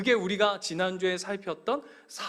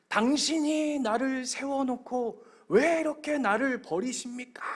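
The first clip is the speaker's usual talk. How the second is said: high, drawn-out cry of protest; slow, anguished stage outcry; acted angry wail; 6 semitones higher than usual. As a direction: act out an enraged actor crying out to God. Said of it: "벽을 치면서 막 분노하면서 절규하는".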